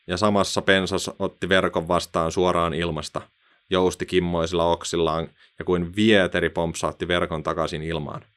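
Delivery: ordinary delivery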